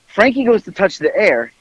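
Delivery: normal talking voice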